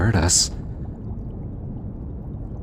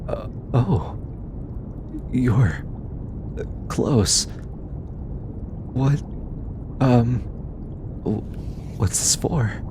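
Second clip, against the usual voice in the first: flustered; 3 semitones higher, slower than usual. Acted. Flustered as listener comes close.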